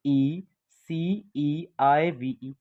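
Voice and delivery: "C E I V E" spelled letter by letter, slowly; slow spelling out. Shown as a demonstration. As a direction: spell "C E I V E" out one letter at a time. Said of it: "receive की spell क्या होती है". (अक्षर-अक्षर धीरे-धीरे spelling)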